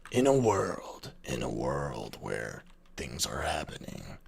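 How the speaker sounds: deep epic "In a world" narrator; slow, low and dramatic; movie trailer voice. Shown as a demonstration. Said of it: "speak with a movie trailer narrator voice".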